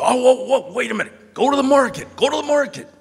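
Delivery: high, loud imitated outburst; urgent interruption. Ken stopping the drive back, urgent and loud. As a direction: halt the driver with an excited yell.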